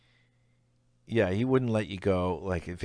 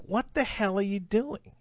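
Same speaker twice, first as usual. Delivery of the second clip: angry retort; 11 semitones higher than usual. Retold or imitated. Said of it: "I'm like".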